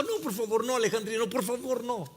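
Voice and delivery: high-pitched begging; imitated anxious pleading. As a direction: plead urgently to stop a fight. Acted begging cousin not to fight.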